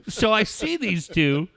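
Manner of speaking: usual speaking voice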